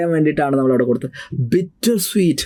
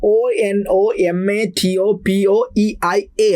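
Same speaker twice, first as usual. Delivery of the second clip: slow, letter-by-letter dictation; slow dictation pace; spelling aloud; 2 semitones higher, much slower than usual. Shown as a demonstration. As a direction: spell it out slowly so students can write it. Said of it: "spelling നിങ്ങളൊന്ന് നോക്കി വെക്കുക".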